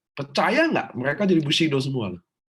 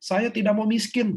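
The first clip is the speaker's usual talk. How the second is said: high-pitched demo cry of what not to say; raised, emphatic affirmation voice; shouted negative affirmation; 11 semitones higher, 4 dB louder than usual. Shown as a demonstration. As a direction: call it out high as a bad example. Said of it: "Jadi nggak bisa gini".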